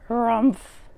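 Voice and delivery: bear grunt. Acted. Old Bear grunting at the kitchen smells.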